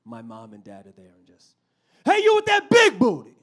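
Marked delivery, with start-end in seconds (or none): 2.0-3.4 high, loud catcall to a man; loud catcalling woman. Retold or imitated. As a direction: holler at him across the park. Mom catcalling dad.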